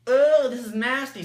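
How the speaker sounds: loud, high, slow groan of disgust; drawn-out loud and high nasty. Retold or imitated. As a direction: really sell how gross the chips are. Second snack, bigger reaction.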